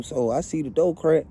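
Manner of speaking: ordinary delivery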